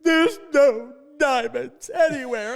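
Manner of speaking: put-on high, slow, loud whine of a let-down boy; disappointed kid. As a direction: wail it like a crushed nine-year-old. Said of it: "And it's like".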